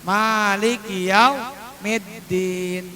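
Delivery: drawn-out, slow chanted "Maliki yaumiddin"; long vowels. Shown as a demonstration. Teacher showing learners the long reading of "Maliki yaumiddin", slowly drawn out. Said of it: "panjang aja bacanya".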